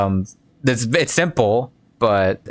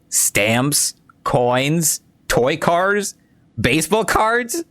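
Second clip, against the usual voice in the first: each guess pitched higher than the last; climbing questions; 4 semitones higher, much slower than usual. Acted. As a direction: list guesses with rising, teasing disbelief. Mocking user lists guesses.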